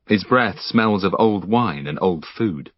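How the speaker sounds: usual speaking voice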